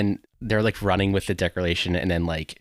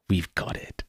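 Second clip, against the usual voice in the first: hushed villain line; 2 semitones lower, 5 dB quieter, slower than usual. Retold or imitated. Quoting Sean Bean's villain, hushed and cool. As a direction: breathe it out quietly, coolly certain. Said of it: "the way he just says".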